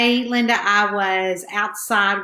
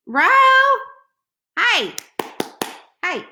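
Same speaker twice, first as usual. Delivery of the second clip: short, higher-pitched calls; calling a pet; 11 semitones higher, 3 dB louder than usual. Real, not acted. Woman calling her cat Rowena mid-livestream, quick calls. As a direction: call out to get a pet's attention.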